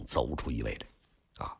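ordinary delivery, nothing marked

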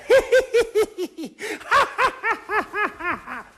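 high, giddy maids' laughter; mock giggling. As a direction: cackle like silly palace maids. (宫女们尖声嘻嘻哈哈大笑)

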